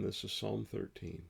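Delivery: ordinary delivery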